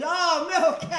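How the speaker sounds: high, loud, eager mock barista; mock barista excitement. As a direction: ham up the barista's delight over the milk. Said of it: "He goes".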